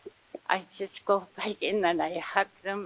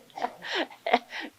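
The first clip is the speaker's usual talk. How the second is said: breathy laugh; 6 semitones higher than usual. Real, not acted. Laughing at big words.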